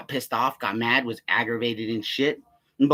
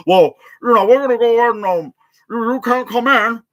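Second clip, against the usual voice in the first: very high, loud caricature; mocking security guard; 10 semitones higher, 9 dB louder than usual. Guard refuses him entry, retold in loud mocking voice. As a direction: ridicule him with an exaggerated high voice.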